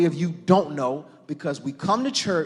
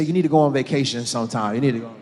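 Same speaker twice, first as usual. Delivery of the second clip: said to your neighbor; 6 semitones lower, 3 dB louder, faster than usual. Pretend. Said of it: "Look at your neighbor and say".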